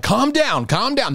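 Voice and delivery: high, soothing calm down; calming people. Retold or imitated. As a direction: settle them down gently. Refereeing upset parents in hallway.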